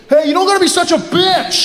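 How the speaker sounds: high, shouted mock outrage; indignant hammed-up yell; creepy guy turning nasty. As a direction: imitate a rejected creep lashing out. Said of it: "That creepy guy comes up".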